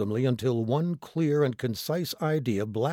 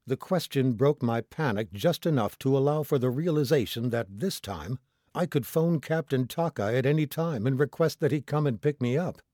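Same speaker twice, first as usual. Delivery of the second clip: ordinary delivery, nothing marked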